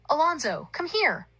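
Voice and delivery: high-pitched call across a crowd; yelled summons. A narrator's reading. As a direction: call him over, pitched well up. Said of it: "Barry yelled".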